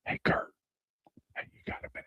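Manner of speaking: hushed, voiceless whisper; furtive whisper. Retold or imitated. Husband secretly calls back the car adviser, furtive whisper. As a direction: whisper it like a secret phone call.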